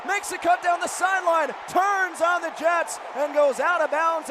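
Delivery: high, loud call of the long run; excited play-by-play. Acted. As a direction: build the call as he breaks free.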